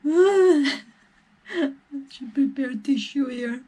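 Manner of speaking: high tearful break; reaching for a tissue mid-sob; crying. Real, not acted. Vlogger asks for a tissue while crying. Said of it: "I'm crying".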